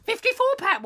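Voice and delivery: very high, incredulous squeal; hammed-up shock; mock disbelief. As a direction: repeat the price in high-pitched outrage. Customer reacts to price.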